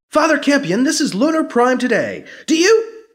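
high, loud pushy caller; eager reporter. Acted. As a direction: rush the question. Reporter calling.

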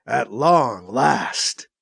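usual speaking voice

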